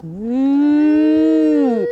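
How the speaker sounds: drawn-out playful mooing; cow moo. Shown as a demonstration. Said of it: "Cow pose".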